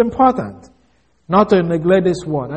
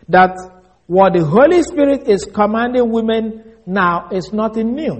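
ordinary talk, nothing marked

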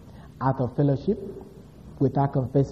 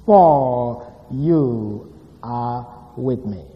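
very slow, word by word; solemn, spaced-out scripture reading; slow verse reading; 2 semitones lower, much slower than usual. Real, not acted. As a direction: draw out each word of the verse. Reads the key verse aloud slowly.

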